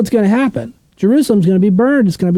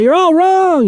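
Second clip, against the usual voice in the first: sudden high, bold declaration; playful high-pitched jab at everyone; mock proclamation; 11 semitones higher, slower than usual. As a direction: announce it like a provocation. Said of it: "It's like".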